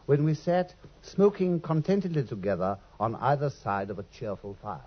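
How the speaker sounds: gentle fireside narration; story narration. A narrator's reading. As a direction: lightly draw listeners back to Baker Street. Watson narrates the frame story.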